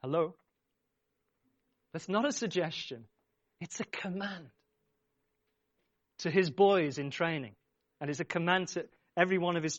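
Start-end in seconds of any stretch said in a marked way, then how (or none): none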